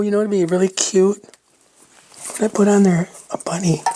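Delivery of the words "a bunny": whispering. Real, not acted. Musing to herself while crafting.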